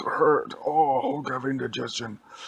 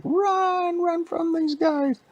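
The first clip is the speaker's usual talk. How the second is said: very high, loud, slow granny cry; a squeaky old woman yelling to flee; old lady voice; 5 dB louder, much slower than usual. Acted. Old lady fleeing the monsters, high squeaky cry.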